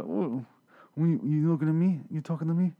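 a light, squished-face "You talking to me?" impression; De Niro impression. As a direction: do a half-hearted De Niro tough guy. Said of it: "doing an impression of De Niro".

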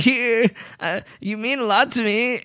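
high, trembling mock confession; quivering fanboy. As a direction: wobble it out like an overwhelmed fan. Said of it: "the way you do that quivering voice".

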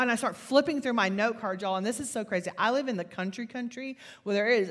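ordinary delivery

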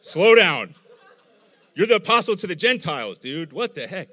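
loud, high, laughing through the angels' protest; laughing angels. Retold or imitated. Preacher voicing angels checking Paul, loud laughing. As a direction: hold Paul back with a laugh. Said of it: "The angels are like".

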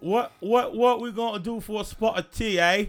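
high Cockney chimney-sweep voice; bad Cockney accent. Victorian Londoner asking about tea, high bad Cockney accent. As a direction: ham up a Cockney bloke. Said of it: "there's gonna be some bad voices".

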